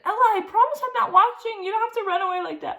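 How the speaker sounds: imitating the teacher pleading after she ran off. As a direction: plead with the runaway student.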